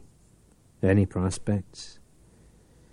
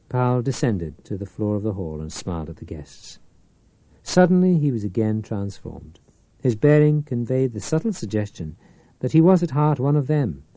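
plain reading voice

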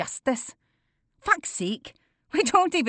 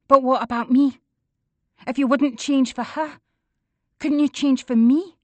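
pleading; 6 semitones higher, 6 dB louder than usual. A narrator's reading. Daughter pleads with father.

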